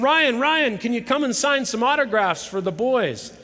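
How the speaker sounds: dad calling to the player for autographs, loud and eager; calling out eagerly. Retold or imitated. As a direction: call across the room for attention.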